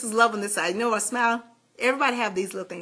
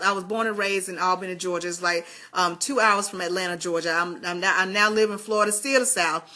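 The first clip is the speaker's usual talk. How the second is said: ordinary delivery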